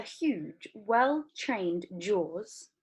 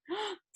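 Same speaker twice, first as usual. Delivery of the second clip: sharp gasp; 14 semitones higher, 7 dB quieter than usual. Acted. Shocked reaction in story.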